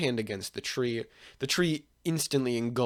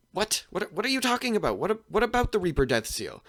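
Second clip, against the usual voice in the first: high-pitched, stammering bewilderment; flustered disbelief; 8 semitones higher than usual. Acted. Hiruzen questioning possessed Naruto about the seal, flustered. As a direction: blurt it out, thrown and baffled.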